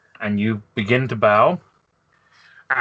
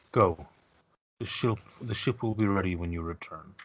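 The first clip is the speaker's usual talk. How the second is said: solemn grandfather; 4 semitones lower, 8 dB quieter than usual. Acted. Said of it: "he nods and he says".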